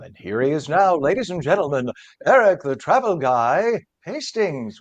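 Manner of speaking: best-worst announcer voice, playfully overblown; hammed-up announcer. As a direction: announce the guest grandly, pitch swooping up, loud, stretching the name. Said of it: "with my best, worst announcer voice".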